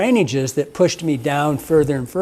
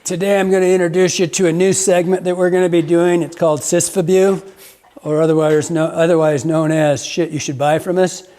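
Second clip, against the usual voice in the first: louder, hammed-up announcer voice; announcer intro; 2 semitones higher, 4 dB louder than usual. Host opening the new merch segment, announcer style.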